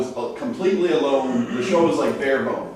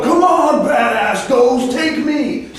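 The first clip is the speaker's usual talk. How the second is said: hammed-up macho ghost hunter, pitched way up; mock provocation; 9 semitones higher, much slower than usual. Host parodies investigators who provoke ghosts, taunting. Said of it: "I don't do provocation".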